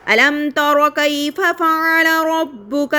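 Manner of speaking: الم نشرح لک صدرک recited slowly, الم joined as one word; Quranic recitation. Shown as a demonstration. Teacher recites Surah Sharh to students, slow and melodic. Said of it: "سورۃ فیل کے اندر بھی پڑھتے ہیں".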